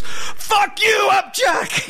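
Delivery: angry shout. Retold or imitated. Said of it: "and he goes".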